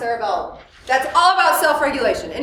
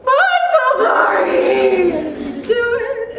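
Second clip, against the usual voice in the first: huge emotional reaction; 8 semitones higher, 5 dB louder than usual. Retold or imitated. Child overreacting to a parent's disappointed look, hammed-up wailing apology. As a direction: imitate a child melting down in apology. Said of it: "big, huge emotional reaction".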